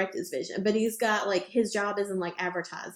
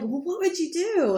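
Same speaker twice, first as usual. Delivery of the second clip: excited what-if; 8 semitones higher than usual. Retold or imitated. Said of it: "long discussion last night".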